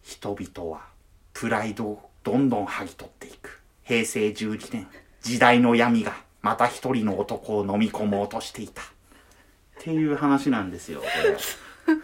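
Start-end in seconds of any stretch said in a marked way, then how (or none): 0.0-8.8 low, hushed, grave documentary narration; documentary narrator voice. Retold or imitated. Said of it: "ナレーションの真似をします。".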